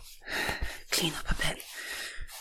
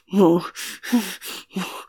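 ordinary delivery

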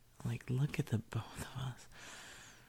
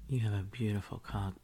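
ordinary talk, nothing marked

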